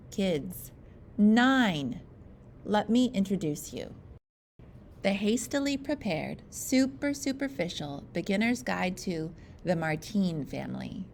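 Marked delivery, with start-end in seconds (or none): none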